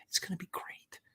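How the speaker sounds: whispered mock promise; conspiratorial whisper of false hype; sly whisper. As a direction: breathe it out like a con. Sarcastic promise of a bad movie.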